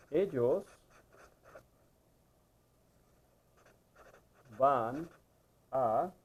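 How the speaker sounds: isolated words with long pauses; slow dictation. Shown as a demonstration. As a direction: say it one word at a time, as if writing. Dictates a new example while writing.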